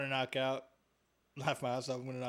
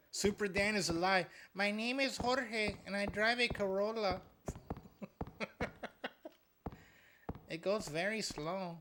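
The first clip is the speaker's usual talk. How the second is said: mock Spanish accent; 6 semitones higher than usual. Streamer joking about his Corolla, higher mock Spanish accent. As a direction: ham up a Spanish accent for the joke. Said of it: "I don't know why I'm doing that accent".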